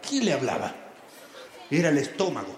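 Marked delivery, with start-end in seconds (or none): 0.1-0.7 low, eerie quién le hablaba; mysterious low voice. Acted. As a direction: ask it like a spooky riddle. Mysterious voice question repeated. (voz grave y misteriosa)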